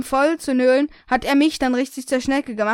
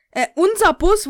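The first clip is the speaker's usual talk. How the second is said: "Äh, unser Bus" said higher and louder, a nervous pupil's start; stammered excuse; 6 semitones higher, 6 dB louder than usual. Shown as a demonstration. Pupil starts an excuse to the teacher, high and loud.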